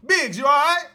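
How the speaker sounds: loud high call to a friend outside. Retold or imitated. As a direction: shout it across the yard. Calling out to Biggs outside.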